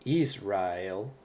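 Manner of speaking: Israel as slow YEES-rah-el; wrong first-syllable stress. Shown as a demonstration. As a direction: lean hard on the first syllable of Israel, slowly.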